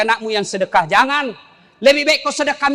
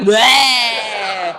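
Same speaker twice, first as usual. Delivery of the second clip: mocking bleh shout. Mocking the English prayer.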